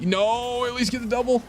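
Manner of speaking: high-pitched cry of protest; real dismay. Real, not acted. Player pleading mid-match as the shot goes wrong, high dismay. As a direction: plead with the game as the play slips away.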